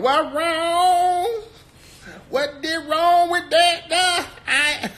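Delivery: put-on very high, loud Bronx-accent parody; AOC's fake Bronx accent. Host debunks her Bronx excuse, exaggerated mock Bronx accent. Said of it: "ain't nobody in the Bronx talking like that".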